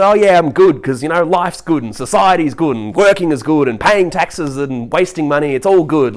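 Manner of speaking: sarcastic everything-is-fine parody; mock cheerfulness. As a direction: reel off the list with fake brightness. Parodying forced cheerful small talk.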